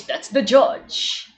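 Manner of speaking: put-on high, very slow old judge; old man voice. Shown as a demonstration. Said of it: "in this old man voice".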